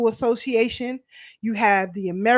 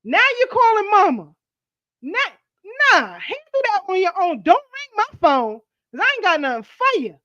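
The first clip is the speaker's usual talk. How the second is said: loud, higher scornful refusal; mocking dismissal; 7 semitones higher, 5 dB louder than usual. Real, not acted. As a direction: brush them off, louder and higher. Mocking dismissal of pleading child.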